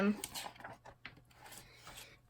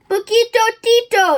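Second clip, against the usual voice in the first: high, loud cry of recognition; exclaiming; 10 semitones higher, 10 dB louder than usual. A narrator's reading. Scapito recognises his little friend, exclaiming. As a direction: shout the name, pitched right up. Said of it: "exclaimed Scapito".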